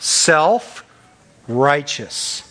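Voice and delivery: Self-righteous stretched very slow and falling; drawn out slowly. Shown as a demonstration. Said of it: "You call that what?".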